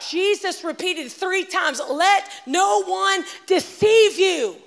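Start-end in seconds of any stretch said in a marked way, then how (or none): 1.9-4.6 slow, high and loud, every word hammered; booming warning. Acted. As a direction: proclaim it slowly like a prophet's warning. Repeats Jesus' warning twice.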